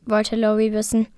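ordinary delivery, nothing marked

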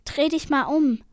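a touch of sudden horror; suddenly horrified. Acted. Selena warning brother about the whirlpool, horrified. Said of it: "plötzlich entsetzt".

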